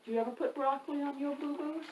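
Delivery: ordinary delivery, nothing marked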